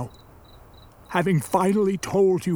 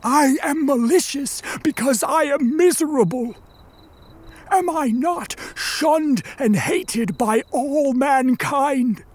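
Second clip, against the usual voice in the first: anguished outrage; 6 semitones higher than usual. Acted. Monster to creator who refused him, anguished outrage. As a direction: plead your misery with bitter force. Monster protests his misery.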